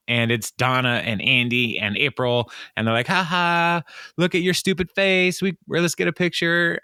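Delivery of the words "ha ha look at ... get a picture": high-pitched mocking gloat; gleeful taunting. Pranksters teasing their new boss, gleeful taunting. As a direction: imitate pranksters crowing over their victim.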